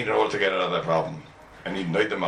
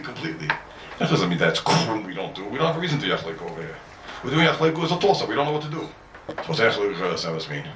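ordinary delivery

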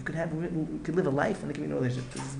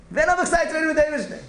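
the repeat cried out high and loud; desperate cry to the Rebbe; anguished outcry; 12 semitones higher, 7 dB louder, faster than usual. Retold or imitated. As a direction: plead it again, louder and higher, like a man at the end of his rope. Man's anguished plea repeated louder.

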